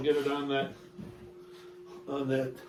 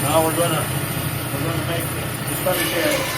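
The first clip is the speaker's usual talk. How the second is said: raised voice over saw noise; shouting over table saw. Real, not acted. Saw turned back on.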